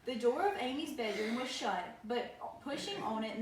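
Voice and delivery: usual speaking voice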